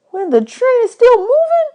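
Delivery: put-on high, incredulous kid voice; can't believe it; surprised. A narrator's reading. Bud hearing how to use the toilet on a train, high and amazed.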